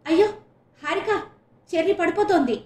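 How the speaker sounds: loud, high alarmed call; frantic shout. A narrator's reading. Nisha calls out as her friend faints, frantic shout. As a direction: yell for help as a friend collapses. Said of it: "గట్టిగా అరుస్తూ".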